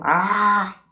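loud, high "ah" of sudden pain; high-pitched yelp of pain; pained cry. Acted. Monkey's sudden pain in story.

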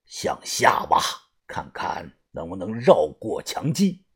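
very low, near-whispered boss voice; soft, husky murmur of command; hushed order. Acted. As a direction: give instructions deep and quiet. Boss gives digging orders.

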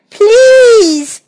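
high, loud pig's plea; crying out. A narrator's reading. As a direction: cry it out high and loud, begging. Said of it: "cried the pig".

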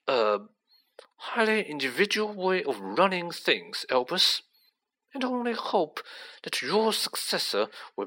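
silky, insincere official; suave menacing villain. Acted. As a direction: feign polite farewell with hesitant sneers.

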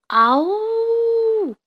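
high squeaky little roar; a baby dino's best roar; tiny squeaky trying to roar. Acted. Baby dinosaur tries to roar.

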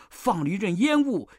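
ordinary delivery, nothing marked

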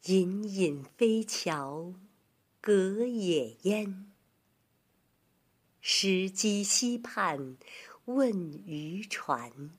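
very slow, measured recital; poetry recitation. Shown as a demonstration. Teacher reciting a Tang poem for children, slow recitation. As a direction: stretch each phrase with long pauses.

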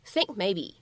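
"think maybe" run together, k held unreleased; incomplete plosion. Shown as a demonstration. Teacher showing learners "think maybe" with an unreleased k. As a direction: stop the k of "think" and go straight into "maybe". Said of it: "非常自然的不完全爆破的处理".

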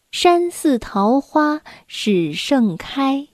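slow, measured classical verse reading; poem recitation. Acted. Storyteller reads a Tang poem to children, slow deliberate recitation. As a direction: recite slowly, with pauses between phrases.

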